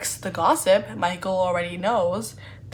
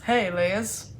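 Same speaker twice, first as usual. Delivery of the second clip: a light Southern drawl, Texas style; attempted Southern accent. Shown as a demonstration. Playing Michael greeting Lizzie in a Southern accent. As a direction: stretch the hey like a Southern charmer. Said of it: "I'm gonna try to do a Southern accent".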